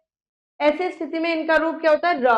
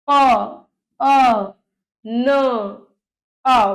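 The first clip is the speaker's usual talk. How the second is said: isolated, lengthened sounds; slow sounding out; 5 dB louder, much slower than usual. Shown as a demonstration. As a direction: leave a clear gap after each sound. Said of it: "अब धीरे धीरे बोलिए".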